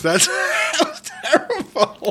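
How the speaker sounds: laughing through the words; giggling at a failed impression. Real, not acted. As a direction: crack up as you say it. Laughing at bad attempt.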